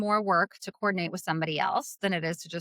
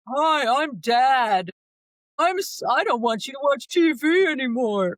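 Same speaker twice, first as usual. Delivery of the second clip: loud, mock dad voice; hammed-up kid playing the father; kids imitating dad; 4 semitones higher, 6 dB louder, slower than usual. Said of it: "like how my kids do it".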